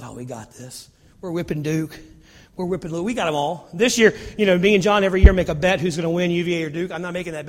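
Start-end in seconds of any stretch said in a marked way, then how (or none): 0.0-3.7 low, soft, easygoing fan; relaxed confidence. Acted. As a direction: say it leaning back, totally unworried. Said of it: "watched the games lean back, resting".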